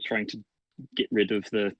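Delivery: usual speaking voice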